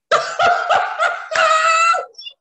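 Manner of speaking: high, loud cackling shriek; wild laughing scream. Real, not acted. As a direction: burst out in disbelieving laughter. Laughing at free match.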